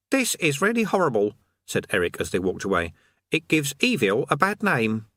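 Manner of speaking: put-on high, earnest boy voice; a priggish teenage know-it-all; young boy Eric. Eric speaks.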